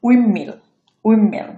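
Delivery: "উইন্ডমিল" as wim-mill, no D; assimilated pronunciation. Shown as a demonstration. Teacher models the word, "উইন্ডমিল" as wim-mill. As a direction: merge N-D into M in "উইন্ডমিল". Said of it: "সেটা কিন্তু এখানে থাকতেছে না".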